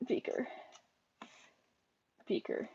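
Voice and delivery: softly calling the bird's name. Real, not acted. Softly calling the bird Beaker. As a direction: call softly, barely above a breath.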